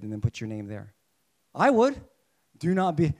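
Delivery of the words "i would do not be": excited I would; eager exclamation. Real, not acted. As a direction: blurt it out eagerly. Eager personal wish.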